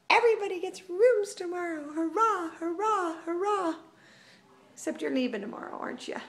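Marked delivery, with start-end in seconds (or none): 0.1-3.7 high mock-cheer to the cats; cheery sing-song. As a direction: announce it like great news, higher.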